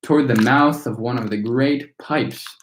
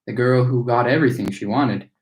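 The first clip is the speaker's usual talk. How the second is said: plain reading voice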